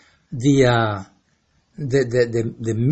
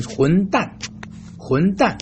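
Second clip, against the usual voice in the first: tap-1; 3 semitones higher, 3 dB quieter than usual. Shown as a demonstration. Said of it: "you can yell at this person".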